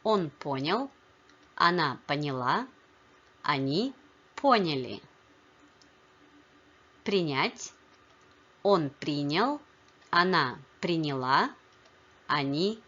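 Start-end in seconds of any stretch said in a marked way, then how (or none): 1.6-2.7 поняла stressed on the last syllable, понялА; stress on ending. Shown as a demonstration. Teacher models another shifting feminine form, stress on last syllable. 10.1-11.5 приняла stressed on the last syllable, принялА; stress on ending. Shown as a demonstration. Teacher models feminine past of принять, stress on last syllable. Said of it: "the stress only shifts in the feminine".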